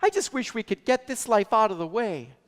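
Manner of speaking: mock-weary complaint voice; weary wish. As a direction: whine it like someone tired of life. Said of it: "when we say things like this".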